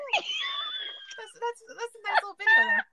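high, loud, laughing imitation of dad. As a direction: chuckle out the line like an amused father. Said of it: "And he's laughing".

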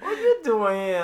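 high, loud put-on voice; mock-surprised hello in a silly voice; surprised greeting. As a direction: hail Lauren in an odd character voice. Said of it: "And I took the man".

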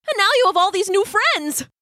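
high, gushing crab voice; excited Brock voice. Acted.

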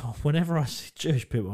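ordinary talk

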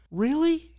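very high, astonished question; stunned. Retold or imitated. Barista learning the shoes are for him, stunned. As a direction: shoot the pitch up in disbelief. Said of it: "with a stunned look".

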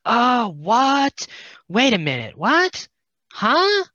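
loud, high, rising mock confusion; baffled disbelief. Viewer reacting to a plot twist that makes no sense, hammed-up bafflement. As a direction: pile up the questions, each shooting up in pitch.

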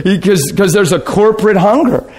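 ordinary talk

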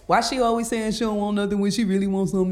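mock man's complaining voice; lightly put-on man voice. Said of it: "my, my voice".

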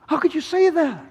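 high, appalled question; shocked reproach. Acted. Onlooker reproaching Jesus for mocking the man, shocked. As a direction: ask it in pained disbelief.

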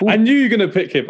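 high, delighted I-told-you-so; excited. Real, not acted. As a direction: exclaim it in real triumph. Delighted at predicted pick.

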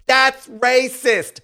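high, drawn-out mock cry; mock racism accusation. Podcaster waving off a whole rant, high mocking catchphrase. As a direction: squeal it slowly like a catchphrase. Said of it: "get on the whole ... rant".

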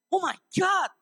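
high-pitched cry of exasperation; dramatic preacher's outcry; exasperated exclamation. Real, not acted. Exclaims at congregation after scolding.

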